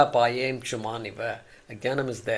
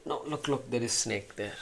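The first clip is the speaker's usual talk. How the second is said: hushed and fearful, much softer; scared hush; 4 semitones lower, 12 dB quieter than usual. Acted. Frightened man warns his rescuer, hushed and scared. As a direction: whisper it like the snake might hear.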